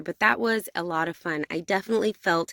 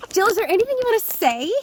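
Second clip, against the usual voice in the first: high, loud, quick question; excited, rushed invitation to speak; playful prompt; 13 semitones higher, 7 dB louder, faster than usual. Real, not acted. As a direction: toss it to the guest in a high sing-out. Playfully handing over to guest.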